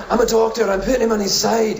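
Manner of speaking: high, insistent Scottish doctor; mock indignant Scottish protest. Doctor arguing with first aider, high and insistent.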